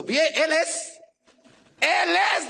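high, loud imitated exclamation; reconocimiento emocionado. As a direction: act out a listener suddenly recognising a voice. Listener recognises his voice. (exclamación aguda de quien lo reconoce)

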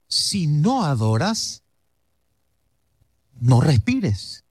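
slow, weighty, each word set apart; slow solemn phrase. Shown as a demonstration. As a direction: say it slowly to your neighbour. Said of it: "al que está a tu lado decirle". (despacio y con peso)

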